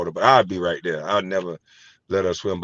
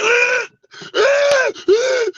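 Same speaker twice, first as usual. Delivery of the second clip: very high, loud mock scream; imitated scream; 7 dB louder, much slower than usual. Imitating a woman screaming on the bungee jump, high mock shriek. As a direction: shriek like you're falling off the bungee. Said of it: "She was screaming so hard".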